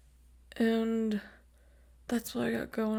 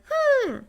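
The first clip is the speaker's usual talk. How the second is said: high, loud mocking hum; 9 dB louder than usual. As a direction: squeal a mocking hmm. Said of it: "He's like".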